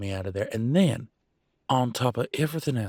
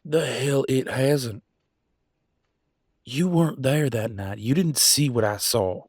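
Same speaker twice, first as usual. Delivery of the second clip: heated, raised-pitch rebuttal; indignant; 4 semitones higher than usual. Acted. Arguing with someone who wasn't there that night, indignant. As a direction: push back hard, stressing the words.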